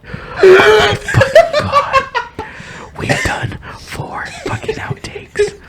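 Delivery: high, slow giggly whisper; laughing whisper. Real, not acted. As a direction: wheeze it out through laughter.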